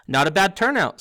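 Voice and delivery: high, loud, pleased boast; proud. A narrator's reading. Ghost host surveys his party guests, proudly. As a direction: boast proudly, full-voiced. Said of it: "said Nearly Headless Nick proudly".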